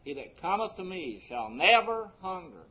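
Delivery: emphatic high "never" in a recited verse; stress on NEVER. Shown as a demonstration. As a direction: make "never" the peak of the verse. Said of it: "I want to emphasize that word never".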